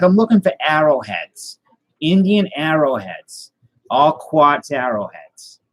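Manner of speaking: slow, drawn-out mock Boston; imitated Boston accent, starting high. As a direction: stretch each phrase in a broad Boston accent. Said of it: "why do you have a Boston accent".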